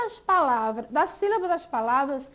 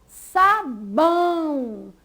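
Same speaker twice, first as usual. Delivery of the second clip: sabão split in two, final bão held long; stress on BÃO; 3 dB louder, much slower than usual. Shown as a demonstration. Teacher showing the stronger syllable, sabão with stressed, drawn-out bão. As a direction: lean hard on bão in sabão. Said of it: "bão foi pronunciada com mais força".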